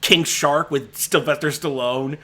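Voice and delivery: usual speaking voice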